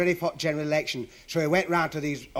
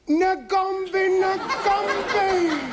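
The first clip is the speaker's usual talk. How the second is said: very high chanted yell; imitated crowd shout; 4 dB louder than usual. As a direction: shout it like a whole excited crowd, last syllable held. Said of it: "all Africans shouted".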